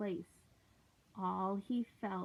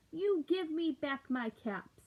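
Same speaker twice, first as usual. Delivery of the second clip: higher scolding demand; 6 semitones higher, 3 dB louder than usual. Acted. Peddler demands his caps from the monkeys. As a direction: tell them off, wanting the caps back. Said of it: "he said, shaking a finger".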